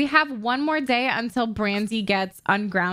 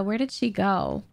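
ordinary talk

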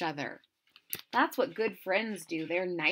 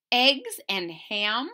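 ordinary delivery, nothing marked

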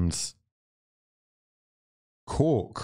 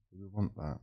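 much softer, half under the breath; muttered to himself; 11 dB quieter than usual. Real, not acted. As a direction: ask it again quietly, as if to yourself. Repeats question, then drops voice.

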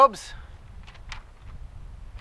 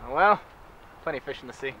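ordinary talk

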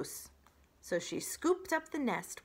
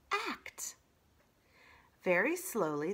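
plain reading voice

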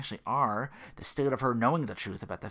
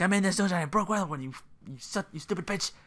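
mock rage; 6 semitones higher, faster than usual. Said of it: "She's like".

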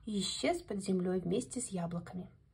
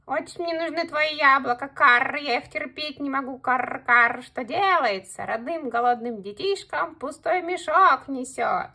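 scolding crow; 4 semitones higher, 9 dB louder than usual. Acted. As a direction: caw and scold with disdain.

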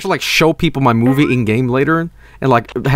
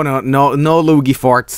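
ordinary talk, nothing marked

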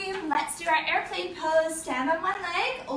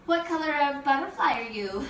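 normal talking voice

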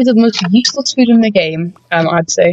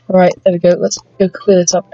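ordinary talk

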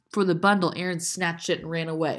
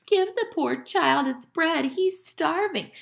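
high, exaggerated mock pity; 11 semitones higher, slower than usual. A narrator's reading. Aaron mocks Ellen before the crowd, high jeer. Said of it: "Aaron mocked her".

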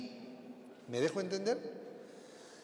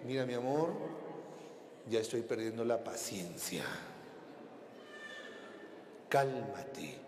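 lower, husband holding back his temper; low husband voice telling his wife to calm down; restrained husband; 7 semitones lower, 5 dB quieter than usual. Retold or imitated. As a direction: warn her, low and tight. Husband losing patience.

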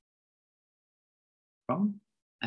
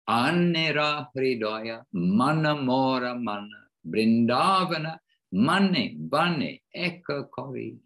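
verse chanted in Bengali, higher and louder; recited Bengali verse; 4 semitones higher, 3 dB louder than usual. Real, not acted. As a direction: recite the Bengali lines devotionally. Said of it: "this is Bengali".

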